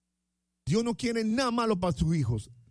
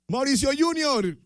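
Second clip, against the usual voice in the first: normal talking voice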